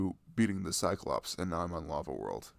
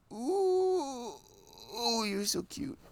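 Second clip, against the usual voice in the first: much higher, drawn-out coo; much slower than usual. Real, not acted. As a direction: gush over how cute your partner is. Cooing at partner while cuddling.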